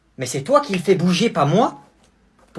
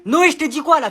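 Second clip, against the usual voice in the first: raised, higher scolding call; calling out sharply; 6 semitones higher, 4 dB louder than usual. Acted. Mother calling her son Noé, sharp and raised. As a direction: snap at the kid from the doorway.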